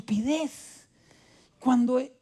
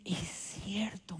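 whispered concession; 12 dB quieter, much slower than usual. Retold or imitated. Wife quietly concedes the point.

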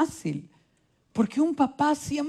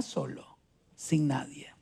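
grave whisper; 8 semitones lower, 6 dB quieter than usual. Retold or imitated. Warning the doctor of his fate.

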